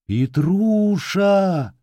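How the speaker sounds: slow, high drawn-out call. Acted. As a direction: call his name from afar. Calling from the plots.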